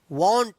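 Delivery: वांट said "wawnt"; rounded aw in वांट; correct "aw" vowel. Shown as a demonstration. Teacher repeating the correct pronunciation for learners, with aw. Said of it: "इसका सही उच्चारण है".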